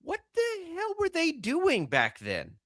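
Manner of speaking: high, slow, disbelieving; high, slow and astonished; incredulous future voice. Retold or imitated. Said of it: "and say".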